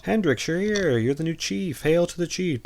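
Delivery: put-on high, slurry drunk cop voice; lightly hammed drunk impression; drunk Roy Scheider. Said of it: "in struts a drunk Roy Scheider".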